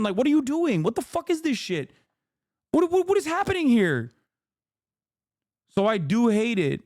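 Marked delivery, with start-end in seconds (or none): 0.0-4.1 high-pitched, incredulous outburst; exasperated disbelief. Real, not acted. Streamer challenging a convert's motives, exasperated disbelief. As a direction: pitch climbing with each baffled question.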